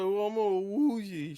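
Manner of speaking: high, drawn-out mock woozy voice; hammed-up dazed wobble; woozy scream impression. Imitating Aunt May getting up after the hit, woozy. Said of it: "She does her best, uh, scream impression".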